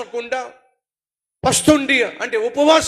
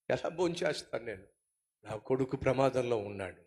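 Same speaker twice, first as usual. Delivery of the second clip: much softer and lower, heavy; grieving father; 12 semitones lower, 15 dB quieter than usual. Acted. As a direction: answer the servants through grief. David answering in grief.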